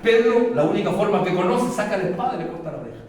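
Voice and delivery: loud, high, driving sermon voice; full-force preaching. Acted. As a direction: preach it out to the whole church. (predicación a todo pulmón)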